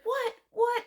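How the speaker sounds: very high-pitched, squeaky double take; exaggerated falsetto surprise; mock shocked disbelief. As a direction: imitate a startled wife. Mimics her reaction to H's verdict.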